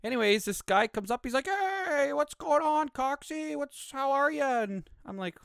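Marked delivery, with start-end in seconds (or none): 1.5-4.6 high, drawn-out mock hello; friendly greeting. Guy coming up through the crowd, high-pitched friendly greeting. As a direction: imitate a guy hailing him in a high-pitched voice.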